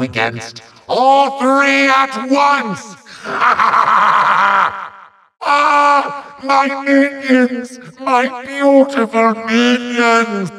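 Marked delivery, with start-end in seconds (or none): 3.2-4.9 triumphant villain cackle; gloating staccato ha-ha-ha; evil laugh. Acted. Villain gloats before attack. 5.4-10.5 high, hammy cry of loss; mock-tragic villain lament; melodramatic wail. Villain wails over lost minions.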